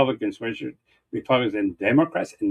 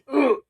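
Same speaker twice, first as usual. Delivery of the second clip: much higher mock groan of disgust; disgusted ugh; 13 semitones higher than usual. Imitating how the right reacts to Nixon, high disgusted ugh. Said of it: "how much".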